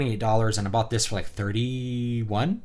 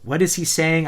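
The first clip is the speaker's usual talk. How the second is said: higher-pitched puzzled question; baffled reader; 6 semitones higher, 4 dB louder than usual. Retold or imitated. Reader halted by heavy phonetics, mildly baffled. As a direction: stop short, confused by what you read. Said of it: "I'm like".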